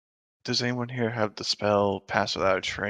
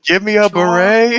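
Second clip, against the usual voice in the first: high, loud mishearing; deafened shout; 9 semitones higher, 10 dB louder than usual. Acted. Deafened listener mishearing a friend, high mock shouting. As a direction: shout it like someone who can't hear.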